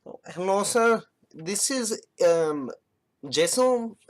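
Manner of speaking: high, loud put-on scam caller voice in a mock Indian accent. A support scammer greeting his mark, high and loud. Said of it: "I had one that was like".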